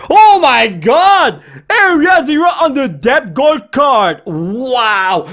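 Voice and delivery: slow, high, loud exclamation; astonished shout. Real, not acted. Card opener revealing a gold card, astonished shout. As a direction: belt it out, stretching the words.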